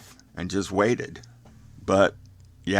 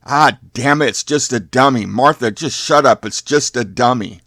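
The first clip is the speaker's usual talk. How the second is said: loud, higher, annoyed driver; exasperated shout; 4 semitones higher, 8 dB louder than usual. Acted. Driver to screaming Martha on finding a dummy, exasperated shout. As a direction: shout it like a fed-up man acted in a story.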